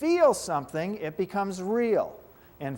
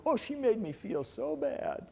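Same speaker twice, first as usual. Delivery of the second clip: higher, mock whiny complaint; mock self-pity; 8 semitones higher, 3 dB quieter than usual. Teacher mimicking someone blaming another for feelings, mock whiny. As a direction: lightly play the hurt victim blaming her. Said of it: "We buy into the illusion that feeling".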